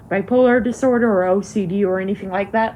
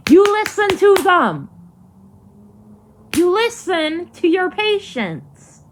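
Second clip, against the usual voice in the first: yelling; 8 semitones higher than usual. Real, not acted. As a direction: hammer the demand home. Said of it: "I was yelling so much".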